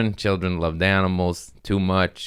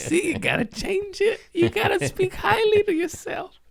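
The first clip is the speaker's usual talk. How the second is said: high-pitched words through real laughter; laughing. Real, not acted. After insulting himself, laughing high through the words. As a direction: giggle the words out.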